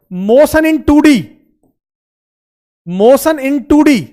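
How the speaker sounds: slow, word by word, for the class to note down; slow dictation. Shown as a demonstration. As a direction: drag each word so students can write. Teacher dictating a term. (धीरे-धीरे, लिखवाने वाले अंदाज़ में)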